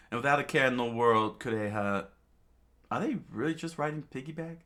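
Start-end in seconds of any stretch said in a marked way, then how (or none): 2.9-4.6 fast, lighter question; quick amused line. Acted. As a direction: rattle it off amused. Kureha laughing question.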